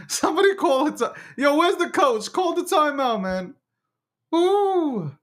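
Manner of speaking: high, squeaky pleas through laughter; helpless giggles. Real, not acted. Host calls for a timeout from the coach, giggling helplessly. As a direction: beg for a break while cracking up. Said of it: "I got the giggles".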